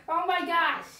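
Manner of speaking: loud shocked gasp. Real, not acted. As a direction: blurt it out from the cold. Entering the ice bath.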